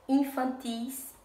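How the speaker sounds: infantis said slowly, final TIS stressed; stress on last syllable. Shown as a demonstration. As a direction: draw out infantis, leaning on TIS. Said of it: "a última sílaba é a mais forte". (infantis devagar, com TIS tônico)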